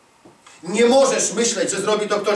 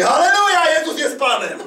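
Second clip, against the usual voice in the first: high-pitched mock cry of praise, easing off; mock shouted hallelujah; 6 semitones higher than usual. Preacher mimicking a pig in a puddle, mock shouted praise. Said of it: "Świnia wpada w kałużę i krzyczy".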